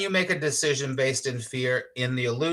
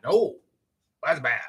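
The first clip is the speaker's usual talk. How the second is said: mock fretting; 8 semitones higher than usual. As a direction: ham up your old busy, judging self. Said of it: "where I was like".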